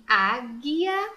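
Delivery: "águia" slow and loud, G straight into I; "águia" enunciated slowly, U left silent. Shown as a demonstration. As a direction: stretch "águia" with no U sound. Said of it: "eu não pronuncio U".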